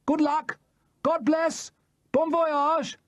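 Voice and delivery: a crowd of shouted goodbyes; shouted farewells. Acted. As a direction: call after the departing boat.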